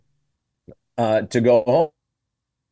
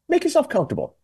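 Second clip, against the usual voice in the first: very high, sing-out hospitality; mock welcome; faster than usual. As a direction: imitate an over-obliging host. Voices city welcoming the homeless.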